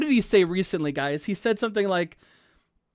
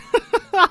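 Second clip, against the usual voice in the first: short high giggle; 13 semitones higher, 6 dB louder than usual. Real, not acted. Streamer giggling while gushing over a character. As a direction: laugh it off, flustered.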